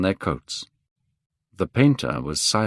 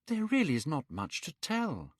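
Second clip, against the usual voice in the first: put-on young Dorian crying out; 8 semitones higher, 10 dB quieter than usual. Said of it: "cried Dorian".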